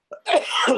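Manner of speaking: sharp breathy choking cough. Real, not acted. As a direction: cough like something caught in the throat. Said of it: "sorry, I'm choking here".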